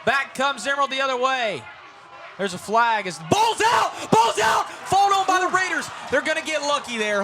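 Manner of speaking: rising shouted fumble call; excited play-by-play. Real, not acted. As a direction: call the turnover at full voice.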